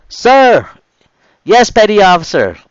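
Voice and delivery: loud, high group shout; loud corrected reply. Retold or imitated. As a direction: bellow the corrected answer. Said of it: "corrected ourselves louder than the previous".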